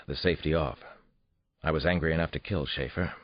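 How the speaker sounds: usual speaking voice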